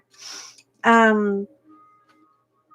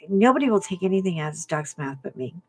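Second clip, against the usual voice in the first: ordinary talk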